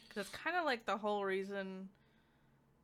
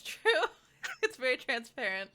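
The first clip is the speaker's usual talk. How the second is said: laughing; 7 semitones higher, 10 dB louder than usual. Real, not acted. Laughing at manipulative character.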